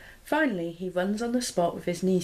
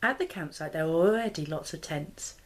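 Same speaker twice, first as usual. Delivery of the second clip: ordinary delivery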